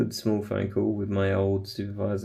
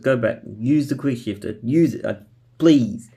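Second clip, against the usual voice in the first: urgent call; 4 semitones higher, 7 dB louder than usual. Retold or imitated. Urging PE teacher to use shifter.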